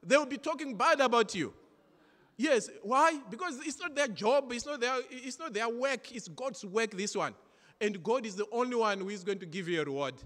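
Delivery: high, loud preacher's exhortation; preaching at full force. Acted. Pastor exhorting the congregation, loud and high. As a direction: drive the message home to the church.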